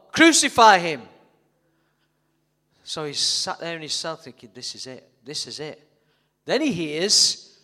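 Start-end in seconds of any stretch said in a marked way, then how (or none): 0.1-1.1 building shout. Acted. Acted crowd shout building. 4.5-5.8 quiet dread. Acted. Barabbas fearful thoughts.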